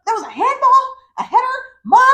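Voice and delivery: high, loud, laughing protest; outraged little ref calling every foul; kid protesting excitedly. Retold or imitated. Son calling fouls to his mom, high and loud. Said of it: "He was like".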